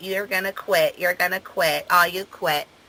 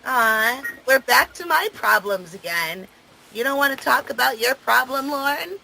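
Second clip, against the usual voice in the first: higher, sing-song fake sympathy; 6 semitones higher than usual. Real, not acted. Taunting a partner who dodges their own problem, mocking baby talk. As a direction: coo at them to taunt.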